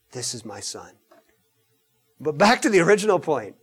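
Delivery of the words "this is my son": hushed God the Father. Acted.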